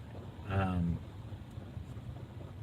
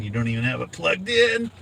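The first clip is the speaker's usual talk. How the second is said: rising, high-pitched incredulity; teasing, incredulous squeal at the end; mock disbelief; 6 semitones higher than usual. Real, not acted. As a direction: climb higher in playful disbelief.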